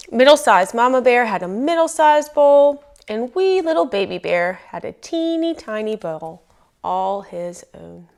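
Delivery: plain reading voice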